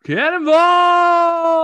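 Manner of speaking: drawn-out high yell; loud shout. Real, not acted. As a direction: bellow it as you leap. Jumping into the pool.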